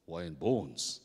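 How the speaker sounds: low-pitched puzzled echo; low adult voice, questioning; puzzled big man. Acted. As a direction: repeat it low, as the grown man. Big neighbour echoes the confession.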